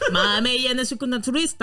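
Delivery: hammed-up Italian-accented French. Player answering the police chief, mock Italian accent. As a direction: put on a joke Italian accent. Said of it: "Bon, bah, italien, ça passe".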